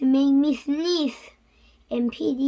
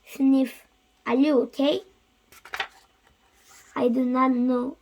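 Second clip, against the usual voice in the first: plain reading voice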